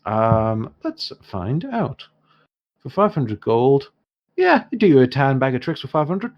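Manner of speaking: lightly Irish dwarf, lifted pitch; dwarf shopkeeper. Acted. Dwarf offers a bag of tricks, lightly voiced. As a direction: think up a price as a canny trader.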